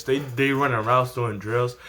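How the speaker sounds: normal talking voice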